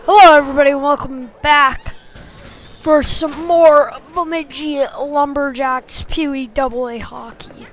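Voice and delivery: ordinary talk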